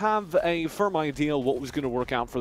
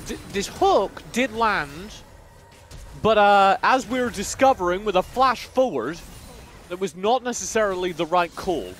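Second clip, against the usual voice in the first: formal newscast delivery; news reporter; 3 semitones higher than usual. Real, not acted. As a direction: read it like a BBC news reporter. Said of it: "you went full B B C News reporter there".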